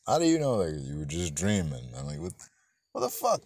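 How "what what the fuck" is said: disbelieving squeak pitched far up; incredulous. Real, not acted. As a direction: react in baffled outrage. Reacting to the silly dialogue.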